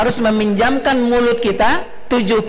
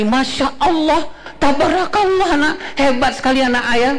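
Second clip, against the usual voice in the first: gushing over-the-top praise; 4 semitones higher than usual. Shown as a demonstration. Father overpraising an older child, high gushing. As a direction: pile on sugary praise, way up high. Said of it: "Apakah ayah akan mengatakan ini".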